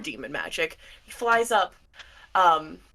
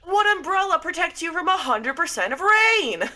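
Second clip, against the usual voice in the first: high-pitched, incredulous argument; exasperated protest; 11 semitones higher, 3 dB louder than usual. Acted. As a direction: argue back, voice pitched up in disbelief. Exasperated cloud character argues back.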